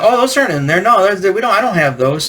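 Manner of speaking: mocking a friend's excuse; imitated lying kid. Imitating kid who kept his figures.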